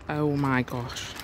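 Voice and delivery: ordinary talk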